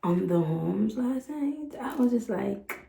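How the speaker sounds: soft, low, close narration; quiet voiceover. Real, not acted. To viewers, a quiet low recap of the trip. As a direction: tell it softly like a late-night recap.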